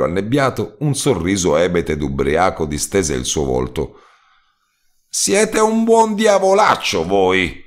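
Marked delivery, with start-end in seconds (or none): 5.1-7.6 slow, much higher delighted cry; exclaiming. A narrator's reading. Relieved roadman exclaiming gratitude to the narrator. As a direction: exclaim with drunken joy. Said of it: "esclamò".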